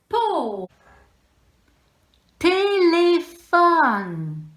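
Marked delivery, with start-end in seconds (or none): 2.4-4.4 "Téléphone" stretched very slowly, loud and high; slow, loud syllables. Shown as a demonstration. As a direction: spell out "Téléphone" slowly for a child. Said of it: "Je compte les syllabes". ("Téléphone" étiré lentement, fort et aigu)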